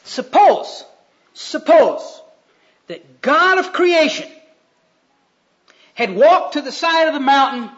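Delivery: high, loud, building sermon; forceful preaching. Real, not acted. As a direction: build the picture up to a shouted command.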